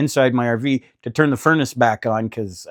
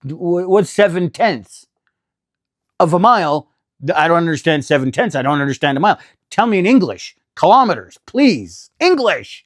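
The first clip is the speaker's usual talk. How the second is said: exasperated rant; 3 semitones higher than usual. Retold or imitated. Driver shouting at the GPS voice, exasperated and pleading. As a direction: rant at the GPS like it's personally insulting you.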